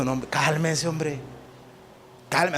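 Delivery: más grave y suave, sereno; Jesús calmando. Pretend. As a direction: tranquiliza a los alborotados. Jesús calma a los discípulos. (Jesús calma a los discípulos con voz suave)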